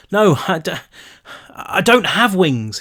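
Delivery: shouted. A narrator's reading. Brick shouting at Rilke in the storm. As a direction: protest you have no wings. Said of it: "he shouted".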